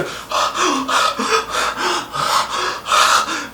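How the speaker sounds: sharp high intake of breath; high shocked gasp. Retold or imitated. Reacting to the sex scene.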